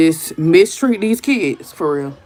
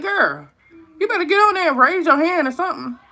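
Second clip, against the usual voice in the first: mock scolding daughter; 8 semitones higher than usual. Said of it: "I be like".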